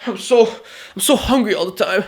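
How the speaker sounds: high, whiny mock voice of a lazy dieter; mock whiny complainer. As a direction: whine like someone who can't stop eating. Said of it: "Are you gonna say".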